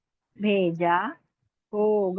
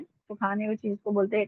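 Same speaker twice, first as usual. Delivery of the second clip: normal talking voice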